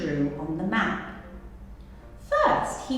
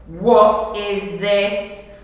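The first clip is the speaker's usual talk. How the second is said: stern demand; 8 dB louder, much slower than usual. Acted. Captain confronts thief.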